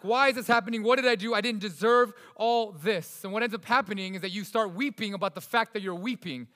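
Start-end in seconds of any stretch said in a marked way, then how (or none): 0.0-3.2 louder, higher pleading questions; acted anguished protest. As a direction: cry out as if wronged. Voicing everyone's protest to God.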